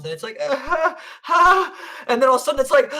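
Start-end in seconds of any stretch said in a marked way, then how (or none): 0.4-1.7 put-on high baby whimpers getting louder; mock pre-scream baby cries; imitated baby building up. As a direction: build toward a wail in short bursts. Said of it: "here it comes".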